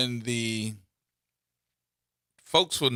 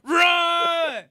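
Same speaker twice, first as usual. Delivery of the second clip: high, drawn-out shout of alarm; mock warning shout; 5 dB louder than usual. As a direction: yell a prank warning from behind. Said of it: "get behind him ... and say".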